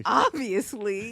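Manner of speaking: slow, pretend-cocky agreement; mock diva confidence. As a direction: stretch it out in playful self-importance. Echoes mock diva boast.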